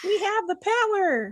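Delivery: high, put-on triumphant voice; mock heroic line. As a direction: proclaim it like a cartoon hero. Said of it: "mock movie line".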